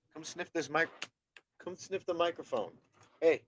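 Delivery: soft, high coaxing to the dog. Real, not acted. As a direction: gently lure the dog closer.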